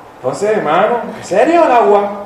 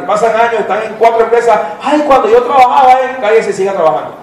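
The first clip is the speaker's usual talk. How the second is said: preaching; 5 semitones higher than usual. Acted. Pastor rebuking the congregation, raised preaching. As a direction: drive the point home from the pulpit.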